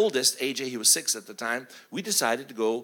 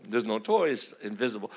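ordinary delivery

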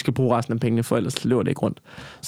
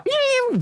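mocking squeal. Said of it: "og så bare".

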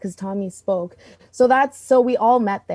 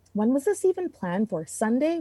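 normal talking voice

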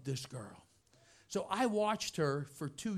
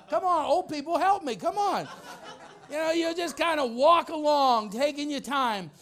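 laughing tease; 8 semitones higher, 7 dB louder than usual. Real, not acted. Mocking slow walkers.

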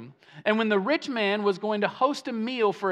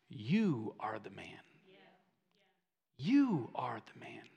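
low, hushed, drawn-out verdict; quiet, weighty confrontation; grave quiet accusation; 9 semitones lower, 10 dB quieter than usual. Acted. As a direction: let the punchline land softly, sinking towards a whisper. Nathan's punchline to David, acted gravely.

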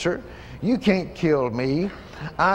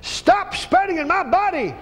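forceful rebuke; 10 semitones higher than usual. Real, not acted. Rebuking cancer in prayer.

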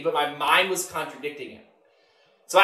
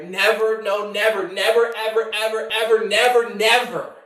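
higher, run-on string of "never" and "ever"; emphatic, unrelenting vow; insistent never; 4 semitones higher, 3 dB louder than usual. Retold or imitated. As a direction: keep piling up "ever" on a raised pitch.

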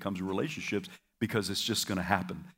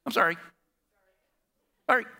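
dismissive sorry; 4 semitones higher, faster than usual. Shown as a demonstration. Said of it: "I don't want you to say".

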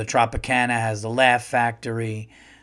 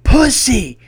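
high, explosive shout; sudden full-voiced yell of a stepfather's guess; imitated scream; 12 semitones higher, 11 dB louder than usual. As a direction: bellow the answer out in triumph. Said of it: "to watch him scream".

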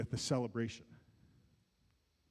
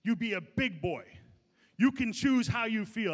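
dad's stern voice; 4 semitones higher, 4 dB louder than usual. Retold or imitated. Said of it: "That's what Randy used to say to me".

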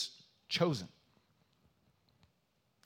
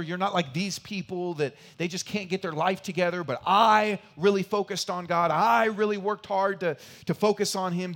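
mock boasting; 6 semitones higher, 8 dB louder than usual. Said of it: "mocking the proud believer's boast".

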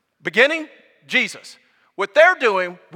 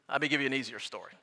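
ordinary talk, nothing marked

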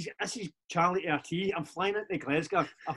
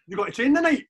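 gaffer asks it louder and higher; the boss's pushy question, raised a little; voicing the gaffer; 7 semitones higher, 7 dB louder than usual. Retelling the gaffer's call, a loud mock boss voice.

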